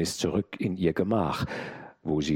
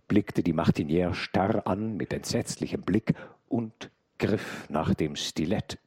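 ordinary delivery, nothing marked

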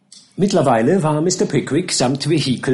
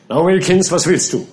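a somewhat higher, louder, commanding master; stern captain; 4 semitones higher, 4 dB louder than usual. Acted. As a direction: bark orders at the gardener. Captain addresses Wilkins. (herrischer Captain)

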